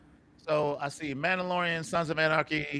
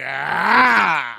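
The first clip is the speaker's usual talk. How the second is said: loud exclamation; 10 dB louder than usual. Acted. Castle blowing up, acted.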